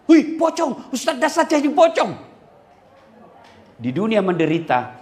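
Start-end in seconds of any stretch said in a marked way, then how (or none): 0.1-2.1 teriak kaget, nada tinggi dan keras; pura-pura kaget ketakutan; kaget melihat pocong. Retold or imitated. (warga kampung berteriak kaget melihat pocong)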